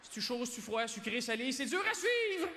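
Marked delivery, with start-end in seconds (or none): 1.5-2.5 high-pitched exasperated squeal; comic bewilderment. Acted. Comedian lost among a singer's mixed ingredients, high bewildered cry. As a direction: pitch it way up, playfully overwhelmed.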